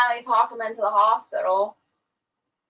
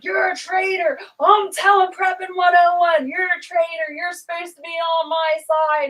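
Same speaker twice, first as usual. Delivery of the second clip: imitating him yelling, higher and louder; retold angry outburst; 7 semitones higher, 3 dB louder than usual. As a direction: throw the accusation back at her, hammed up. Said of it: "He was so mad at me".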